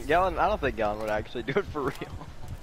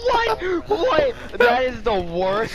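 high-pitched, incredulous outburst; real high squeal of disbelief; amused disbelief; 3 dB louder, much slower than usual. Real, not acted. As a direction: shriek the question high in astonishment. Reacting to a terrible in-game miss.

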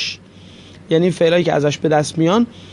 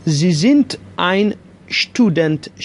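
each word laid out very slowly for learners; very slow classroom demo of a German sentence; slow model sentence; much slower than usual. Shown as a demonstration. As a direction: say it word by word, like dictation. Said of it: "باید بهش بگم".

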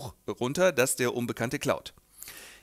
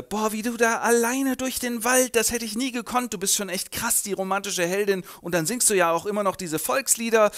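gushing nun friend; 7 semitones higher than usual. Acted. Nun friend gushing over Gabi.

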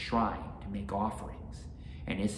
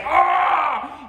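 loud, high ogre cry; ogre's alarmed yell; 16 dB louder than usual. A narrator's reading. Oni as the samurai crawls up him, high yell. Oni yells as hero climbs.

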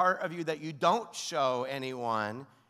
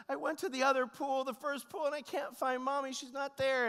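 put-on high-pitched, whiny child voice; retold tearful kid, pitched way up; crying little boy; 12 semitones higher than usual. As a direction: imitate an upset small son explaining himself. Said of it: "he starts crying".

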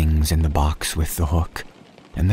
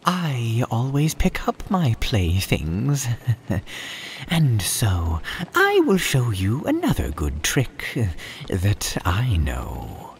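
jolly cat voice; 5 semitones higher than usual. Acted. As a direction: reassure with a big showman's grin. Cat boasting tidiness.

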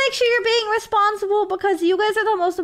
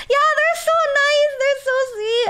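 high, tearful gush; gushing through happy tears; choked-up happy crying; real crying; 6 semitones higher than usual. Real, not acted. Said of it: "you guys are making me cry".

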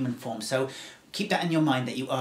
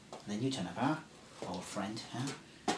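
quiet lonely survivor; 6 dB quieter than usual. Acted. Survivor telling the stranger he thought he was alone, hushed. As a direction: murmur it in disbelief.

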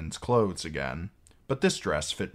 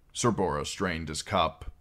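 plain reading voice

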